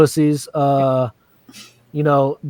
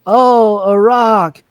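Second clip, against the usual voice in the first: mock delight; 5 semitones higher than usual. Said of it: "she's like".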